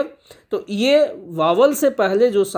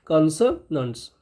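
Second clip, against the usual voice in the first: Consonants lower, split into syllables; broken-up repeat; 4 semitones lower, 4 dB quieter than usual. Shown as a demonstration. Teacher repeating a key term, broken into syllables. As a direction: say consonants in separate, falling pieces.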